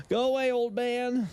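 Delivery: playfully calling out, pitched high; playful shooing. Pretend. Calls out to game character.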